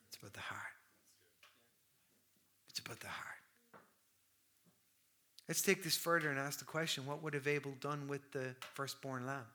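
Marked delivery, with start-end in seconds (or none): 0.1-3.4 soft, whispered repeat; whispered emphasis. Real, not acted. Preacher hushing the congregation to drive home the point, whispered. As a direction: let the words sink in with a hush. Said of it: "repeated, whispered emphasis".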